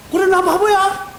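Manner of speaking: shrugging it off. Retold or imitated. Carefree reply to insult.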